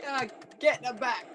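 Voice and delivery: high, urgent egging on; excited cheering. Real, not acted. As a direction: chant it to hype up a player. Cheering on the game shooting.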